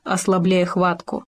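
usual speaking voice

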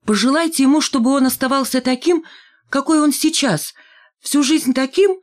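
high, bright call while hurrying away; high call while leaving. A narrator's reading. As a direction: call it back over your shoulder as you leave. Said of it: "И уже на ходу выкрикнула".

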